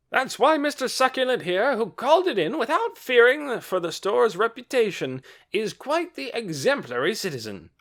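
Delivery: loud, high, gushing; loud enthusiastic praise. A narrator's reading. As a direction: praise him with fervour. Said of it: "speaking with such enthusiasm".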